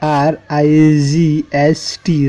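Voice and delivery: slow letter-by-letter spelling; teacher spelling a word slowly for the class. Shown as a demonstration. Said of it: "जैसे right".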